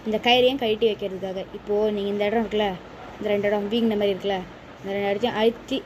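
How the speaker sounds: ordinary talk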